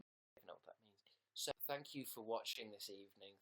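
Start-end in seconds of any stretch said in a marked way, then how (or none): 0.0-1.0 whispered aside. Real, not acted. Admitting to viewers he doesn't understand, whispered. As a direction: drop to a whisper.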